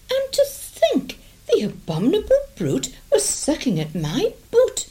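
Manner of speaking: very high, crying outraged lady; outraged lady wail. Acted. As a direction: wail it in horror.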